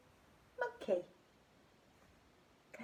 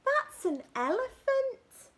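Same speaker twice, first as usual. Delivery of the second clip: high, louder no no no; butterfly eagerly correcting; excited correction; 11 semitones higher, 5 dB louder, slower than usual. Acted. Butterfly telling the little monkey no, high and excited.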